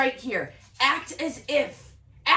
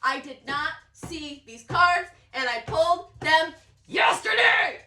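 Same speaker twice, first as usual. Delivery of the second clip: rising, louder disbelief; emphatic amazement; 4 semitones higher, 5 dB louder than usual. Real, not acted. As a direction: punch each word, then shout the last.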